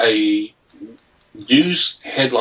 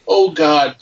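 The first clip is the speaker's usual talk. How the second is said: higher-pitched mock fretting; panicked last-minute prankster; 8 semitones higher than usual. Host imagining a prankster short of a joke, mock panic. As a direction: fret aloud, then settle on any idea. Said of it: "Maybe you just like".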